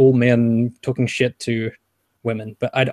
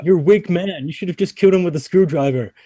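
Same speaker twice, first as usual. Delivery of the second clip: raised-pitch macho taunt; mock scornful; 5 semitones higher than usual. Male friends berating a harassed man, mock scornful. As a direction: imitate mates pouring on scorn.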